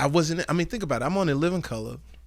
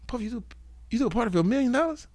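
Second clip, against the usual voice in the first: quick, high, baffled query; incredulous; 9 semitones higher, faster than usual. Retold or imitated. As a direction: question the price in disbelief. Disbelief at party's cost, retold.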